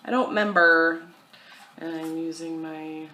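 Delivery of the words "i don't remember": louder repeat. Real, not acted. Repeats the line much louder.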